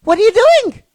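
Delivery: loud, high disbelieving cry; exasperated outburst. Real, not acted. Scolding the studio over its budget, loud exasperation. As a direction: throw up your hands at the studio.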